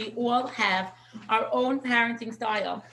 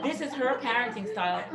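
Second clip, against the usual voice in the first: ordinary talk, nothing marked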